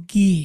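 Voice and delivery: "গি" with a hard g and long ee; German letter G. Shown as a demonstration. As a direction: draw out "গি" as gee with hard g. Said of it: "জিটাকে বলবো".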